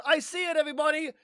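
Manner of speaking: high, loud call to the others below; yelling down. Retold or imitated. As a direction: shout it down from a treetop. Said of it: "he yells down to the rest".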